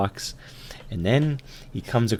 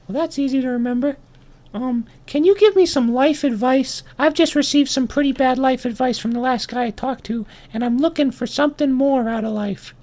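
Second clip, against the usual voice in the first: very high-pitched tiny voice; little ant. Acted. Ant asking the kangaroo for advice, high little voice. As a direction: keep up a squeaky small ant. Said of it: "And he says".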